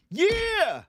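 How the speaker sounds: high, loud hype yell; mock cheer. Host hyping his own segment, loud mock cheer. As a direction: whoop it up for the segment.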